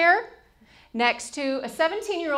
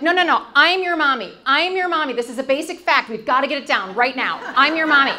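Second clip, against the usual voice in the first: insistent mock correction; 6 semitones higher, 9 dB louder than usual. Correcting her son at pool.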